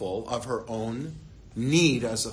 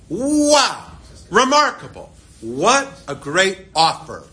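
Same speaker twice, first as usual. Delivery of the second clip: high, louder gushing wow; mock amazement; 5 semitones higher, 5 dB louder, slower than usual. Listener imagining the reply to Boaz, mock amazed. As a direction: gush over the offer like it's too good. Mock reaction to generous offer.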